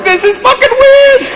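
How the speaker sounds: high squeaky voice. Retold or imitated. Glove voiced.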